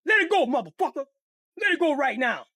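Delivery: Kenny yelling at the man to let go; angry yell. Retold or imitated. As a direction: demand it back, hard. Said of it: "Kenny rips the bike back".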